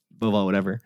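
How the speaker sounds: ordinary talk, nothing marked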